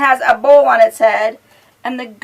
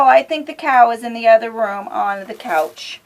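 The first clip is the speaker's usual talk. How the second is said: ordinary delivery, nothing marked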